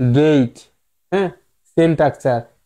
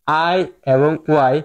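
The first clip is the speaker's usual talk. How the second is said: slow spelled-out letters; slower than usual. Shown as a demonstration. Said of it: "যমজ ভাই".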